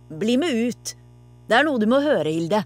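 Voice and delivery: a moderate storybook shout; calling out. A narrator's reading. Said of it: "ropte pappa".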